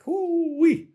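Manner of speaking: very high, loud whoop; whistle-high cry of relief; whooping "hoo-wee". Retold or imitated. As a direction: let out a shrieky whoop. Exclaims at his close call.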